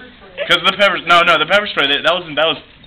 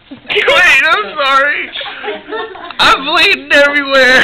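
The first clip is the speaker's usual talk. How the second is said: high, louder fake sobbing apology; mock crying; 4 dB louder, slower than usual. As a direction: sob it out, over the top. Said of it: "Act out your wreck".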